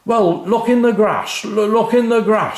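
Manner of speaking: high, loud yell; angry shout. A narrator's reading. Dad yelling at Mum to search the grass, loud and cross. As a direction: bark the order at her. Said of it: "shouted Dad".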